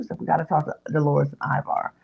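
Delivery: ordinary talk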